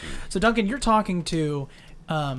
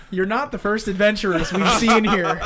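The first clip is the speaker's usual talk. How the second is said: louder, amused delivery with real laughter; laughing through it; 4 semitones higher, 5 dB louder than usual. Real, not acted. Game master retries Adrian's line after fumbling, laughing.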